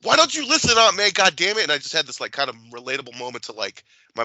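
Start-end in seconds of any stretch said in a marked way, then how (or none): 0.0-1.6 high, loud retold yell at the screen; exasperated yell. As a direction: shout at Aunt May through the TV. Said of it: "I yelled out".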